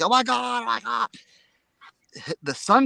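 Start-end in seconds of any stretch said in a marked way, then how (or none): 0.0-1.1 mock hysterical wife. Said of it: "wife comes out hysterical".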